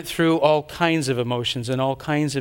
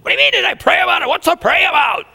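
much higher, louder, fast angry shout; exploding; 12 semitones higher, 7 dB louder, faster than usual. Retold or imitated. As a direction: blow up at them. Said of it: "And then I exploded".

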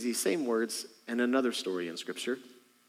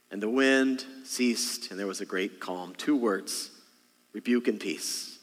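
ordinary delivery

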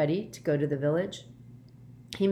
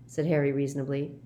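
plain reading voice

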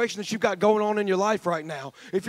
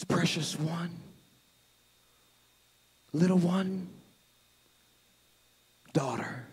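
soft, low, fading to whisper; a parent's hushed plea to a child; tender whisper; 4 semitones lower, 9 dB quieter, slower than usual. Acted. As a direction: coax her gently awake. Jesus tenderly calls the girl.

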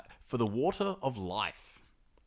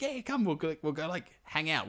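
mock bird brother; 6 semitones higher than usual. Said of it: "He's like".

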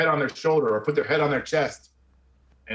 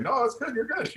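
soft, much higher reassuring voice; 11 semitones higher, 4 dB quieter than usual. Retold or imitated. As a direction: gently tell him he's fine, voiced higher. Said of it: "And he's like".

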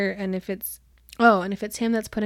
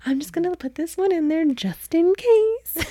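mock sly aside, higher and louder; sneaky Raffen; 8 semitones higher, 5 dB louder than usual. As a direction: play the scheming matchmaker.

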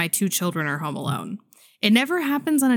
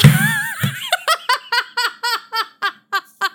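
squealing laugh at the punchline; high laughing burst; 4 dB louder than usual. Real, not acted. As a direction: break into high-pitched giggles. Bursts out laughing at own story.

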